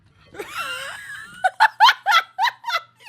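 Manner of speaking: high, loud burst of laughter. Real, not acted. Reaction to Jinx joke.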